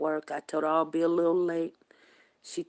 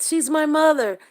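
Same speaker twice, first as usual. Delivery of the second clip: high, loud retold declaration; emphatic insistence; 12 semitones higher, 11 dB louder than usual. Daughter telling staff who came with her, high loud insistence. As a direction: imitate her insisting, pitched way up.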